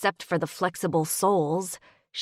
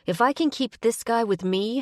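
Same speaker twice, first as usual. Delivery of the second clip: ordinary delivery, nothing marked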